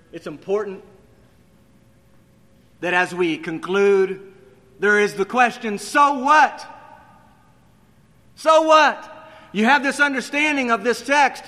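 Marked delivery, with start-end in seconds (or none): 2.8-11.4 louder and higher, building to a cry of so what; climbing preacher's shout; rising preacher's call. Real, not acted.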